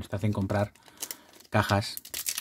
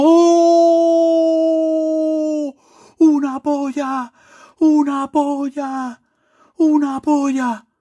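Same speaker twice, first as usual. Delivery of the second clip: high, loud screams of pure joy; ecstatic screaming; 6 dB louder, much slower than usual. Real, not acted. Pulling a rare card on camera, ecstatic high screams. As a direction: shriek like you just pulled the rarest card. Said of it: "Me he emocionado".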